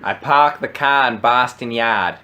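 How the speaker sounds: slow Boston accent, dropped r's; park the car the Boston way, slowly. Shown as a demonstration. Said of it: "What's a Boston accent?".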